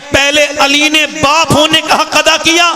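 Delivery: fiery preacher shouting to crowd; booming political rally speech. Acted. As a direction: build to a roaring climax. Sermon climax, high and loud.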